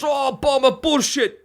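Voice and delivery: high, disbelieving big-guy voice; Taz impression. Friend doubting the broken ribs, high disbelieving voice. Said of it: "He goes".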